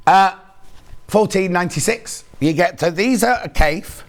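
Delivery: normal talking voice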